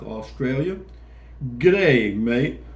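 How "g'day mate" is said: put-on loud, hammy Aussie-accented G'day mate; Australian greeting. Greeting a South Australian viewer, loud mock Australian accent. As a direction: throw out a big Australian hello.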